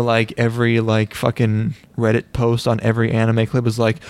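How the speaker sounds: usual speaking voice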